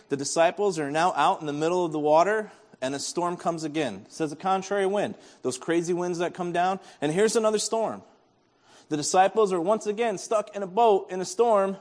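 ordinary talk

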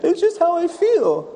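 put-on high child's voice, talking through swollen glands; sick little girl. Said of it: "She's like".

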